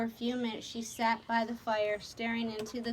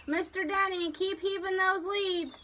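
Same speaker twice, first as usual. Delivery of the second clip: raised, much higher pitch; captain's order; 8 semitones higher than usual. A narrator's reading. Captain ordering men to the chains, calling out. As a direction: call orders through the fog. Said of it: "Nate's voice spoke again through the fog".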